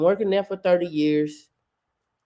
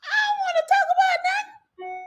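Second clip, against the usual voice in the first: high, wailing mock-crying imitation; mock whiny voice. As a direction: whimper it like a sulking man, hammed up. Mocking the worker's interview.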